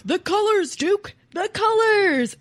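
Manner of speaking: high, loud cry of amazement; excited kid. Retold or imitated. Kid marvels to the dog Duke in a retold candy ad, excited shout. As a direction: shout it like an awestruck commercial kid. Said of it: "the kid was like".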